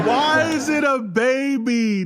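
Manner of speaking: high, drawn-out protest; slow wail of amused incredulity; amused disbelief. Real, not acted. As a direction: stretch the words out in high-pitched disbelief. Reactor in mock disbelief.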